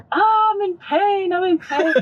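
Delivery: high wailing cry of pain; mock scream. Tattooist imitating client screaming down the block, high wail. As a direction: wail it like she's hurting. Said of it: "she's screaming down the whole block".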